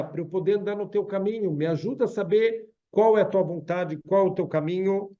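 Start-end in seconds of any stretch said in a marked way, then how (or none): none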